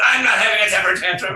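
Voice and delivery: defensive outburst, high and loud; indignant denial. Retold or imitated. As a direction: snap back that you're not throwing a fit. Denying tantrum in retold argument.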